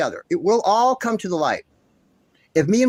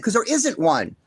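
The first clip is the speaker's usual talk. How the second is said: ordinary delivery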